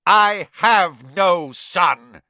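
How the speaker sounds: loud, slow, hammered words; furious bellow. A narrator's reading. Crouch disowns his son before the court, slow bellow. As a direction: bellow each word with finality. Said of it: "bellowed Mister Crouch, his eyes bulging suddenly".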